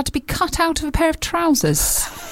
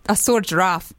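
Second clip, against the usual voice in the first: put-on South African accent; 6 semitones lower than usual. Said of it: "This is my South African accent".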